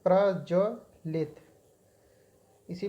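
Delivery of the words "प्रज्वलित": प्रज्वलित said slowly, each syllable clear; प्रज्वलित dictated slowly; slow and careful. Shown as a demonstration. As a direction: stretch out प्रज्वलित for the students. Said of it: "बल्कि प्रज्वलित होता है".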